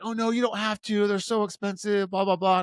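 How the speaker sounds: put-on high-pitched girl's polite protest; imitated protesting date. Date protesting the gift at the concert, imitated high voice. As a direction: mimic her demurring up in a high voice.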